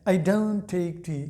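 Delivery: slow, careful I don't take tea, don't said dont with a clear t; model pronunciation. Shown as a demonstration. Teacher shows students the right don't, slow and clear. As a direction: say don't slowly for the class to copy. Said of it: "आपने don't use करना है".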